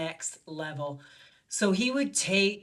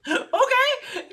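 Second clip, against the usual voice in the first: high-pitched laughing okay; retold bemused agreement; 3 dB louder than usual. Yoga teacher reacting to a sudden jet trip, high and bemused. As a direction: go along with it, voice leaping up in amusement.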